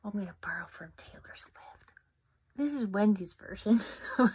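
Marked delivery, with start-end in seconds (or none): 0.0-1.6 quick hushed whisper; whispered aside. Real, not acted. Author whispering an aside to her listeners. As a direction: lean in and whisper it fast.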